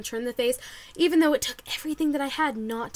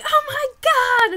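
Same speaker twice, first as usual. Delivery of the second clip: high, loud, drawn-out gasp of joy; excited cry; 12 semitones higher, 6 dB louder, slower than usual. Retold or imitated. Hearing her name at the contest, excited high cry. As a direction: cry it out like you've won.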